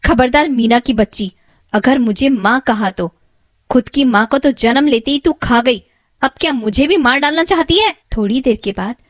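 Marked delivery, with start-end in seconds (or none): 0.1-7.9 louder, higher rebuke, speeding up at the end; loud furious stepmother; angry stepmother. Acted. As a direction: lash out at the girl. Stepmother rebukes Meena angrily.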